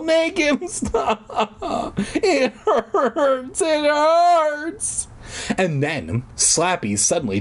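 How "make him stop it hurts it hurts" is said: slow, hammed-up high sobbing wail; high, heartbreaking wail. Little girl begging to be freed, heartbreaking wail. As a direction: wail each word out, quavering and stretched. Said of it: "in a high, heartbreaking wail".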